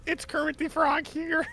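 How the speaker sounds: high, slow nasal-free frog puppet voice; Kermit the Frog voice. Pretend. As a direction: do your best Kermit. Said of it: "could I hear that again".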